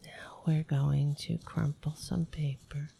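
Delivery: hushed ASMR announcement; ASMR whisper. Pretend. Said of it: "they do this".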